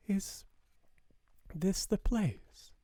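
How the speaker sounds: put-on high, hushed child's whisper. A narrator's reading. Sarah whispering to her father, cuddled close, hushed. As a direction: breathe it out like a secret. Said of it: "Sarah whispered".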